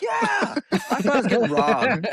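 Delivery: 边笑边说; laughing. Real, not acted. Laughing at the memory.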